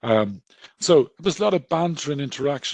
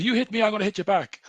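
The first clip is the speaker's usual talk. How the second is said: higher-pitched, punchy hit-me-and-I-hit-back; mock defiant threat; 10 semitones higher, 4 dB louder than usual. Homeless man warns the aggressor, mock defiant. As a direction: fire it back as a dare. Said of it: "started getting aggressive back".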